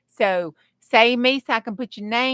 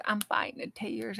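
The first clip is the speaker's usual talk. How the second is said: much softer, choked-back repeat; softly holding back tears; 10 dB quieter than usual. Real, not acted. Streamer moved by milestone, quietly fighting tears. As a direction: say it again under your breath, fighting tears. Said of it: "I'm gonna cry".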